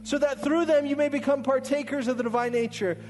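soaring sermon climax; fervent preaching. Acted. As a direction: rouse them to awe. Climactic preaching over music.

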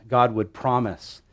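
ordinary talk, nothing marked

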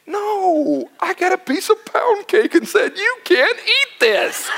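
high, laughing through the pound cake line. Real, not acted. Pastor laughing about eating pound cake, laughing. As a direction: crack up at yourself.

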